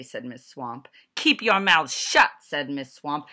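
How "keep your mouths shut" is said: loud, harsh order; stern teacher command. A narrator's reading. Strict teacher barking orders at the class, loudly. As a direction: bark it at the class.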